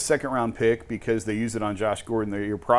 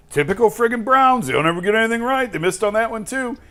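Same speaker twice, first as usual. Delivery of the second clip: much higher and louder, mock exasperated fan; mocking fan rant; 12 semitones higher, 7 dB louder than usual. Mimicking angry Browns fans on social media, mock exasperated rant. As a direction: parody the whiny fan who always blames the team. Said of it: "I saw a lot of reaction on social media".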